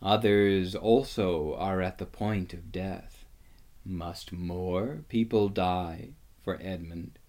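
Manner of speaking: low, solemn reproach; grave. A narrator's reading. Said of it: "said Aslan in a graver voice".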